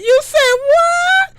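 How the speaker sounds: very high shocked squeak; voice got really high. Retold or imitated. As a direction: let the voice jump way up in shock. Shocked high-pitched outburst.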